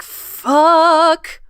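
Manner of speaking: loud, high exasperated swear; a sudden annoyed high-pitched yell; frustrated curse. Retold or imitated. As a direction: blurt it out as the mistake hits. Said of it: "And then I was like".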